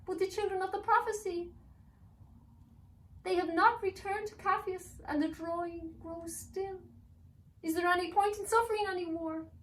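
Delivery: very high, loud elf pleading; desperate elf. Acted. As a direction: beg against hope.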